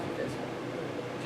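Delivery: slow, whispered repeat; hushed slow afterthought; whispered echo. Acted. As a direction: breathe it out slowly as an echo. Repeating the closing line.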